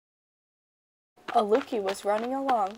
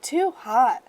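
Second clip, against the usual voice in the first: a touch louder, too hot; lightly voiced complaint; mild complaint; 3 semitones higher, 7 dB louder than usual. A narrator's reading. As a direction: push it out after the burn. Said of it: "she cried out".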